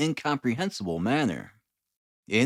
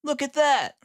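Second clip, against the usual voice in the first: high, bright announcement of a find; triumphant detective; 13 semitones higher, 3 dB louder than usual. A narrator's reading. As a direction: crow over the discovery. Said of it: "he said triumphantly".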